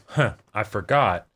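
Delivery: ordinary talk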